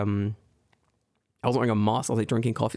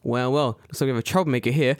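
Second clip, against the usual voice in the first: usual speaking voice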